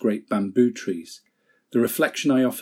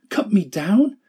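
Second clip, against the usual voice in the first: much higher, anguished question; horrified outcry; 9 semitones higher, 3 dB louder than usual. Acted. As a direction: fling the question back in horror. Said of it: "A trembling of great horror shook Bamboo".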